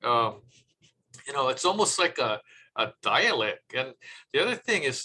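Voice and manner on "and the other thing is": ordinary delivery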